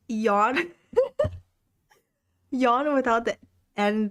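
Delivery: "Yawn" drawn out, slow, as the model vowel; teaching the vowel. Shown as a demonstration. Tutor showing the learner the first sound of Yorkshire, slowly. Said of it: "Don't roll your tongue as much".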